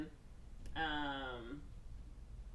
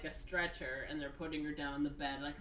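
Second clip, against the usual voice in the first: normal talking voice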